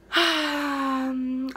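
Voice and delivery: long, loud sigh; real drawn-out sigh. Real, not acted. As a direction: let out a heavy sigh mid-thought. Defending her straightened hair.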